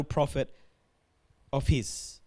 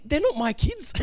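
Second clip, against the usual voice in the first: exasperated Moses; 8 semitones higher than usual. Retold or imitated. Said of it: "He's saying".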